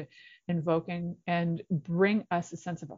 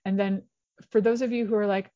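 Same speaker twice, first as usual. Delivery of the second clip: ordinary delivery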